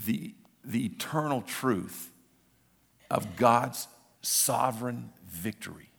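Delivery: slow, hushed and solemn proclamation; quiet, deliberate sermon close; solemn preaching. Acted. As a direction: lay it down slowly like a blessing. Preacher's solemn closing declaration.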